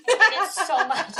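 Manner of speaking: high, loud, laughed-out complaint; laughing loathing. Real, not acted. Parent confessing hatred of a children's game, laughing. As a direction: complain through helpless laughter.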